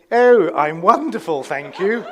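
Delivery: loud, put-on posh English gush; posh English accent. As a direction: imitate her suddenly turning posh English. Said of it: "They don't speak like that normally".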